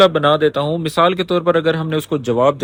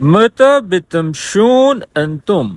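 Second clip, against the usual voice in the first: slow careful repeat; 2 semitones higher, much slower than usual. Shown as a demonstration. Teacher repeating the clip question for learners, slowly. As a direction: say متى بتمشون أنتم slowly and clearly. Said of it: "repeats the played Arabic line".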